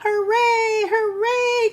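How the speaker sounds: high, drawn-out hooray; storybook cheer. A narrator's reading. Everyone praising the watchdog, high acted cheer. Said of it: "cheered everyone".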